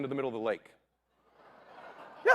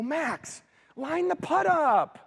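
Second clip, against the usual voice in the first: high, slow, drawn-out coaching call; 12 semitones higher, much slower than usual. Retold or imitated. Father coaching his son on the green, slow and high. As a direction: stretch each word like a stern coach. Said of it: "I go".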